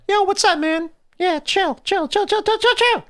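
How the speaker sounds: very high-pitched little kid acting cool; tiny high kid voice, rattling off slang. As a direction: squeak it out like a small boy playing chill. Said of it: "me as a little kid was like".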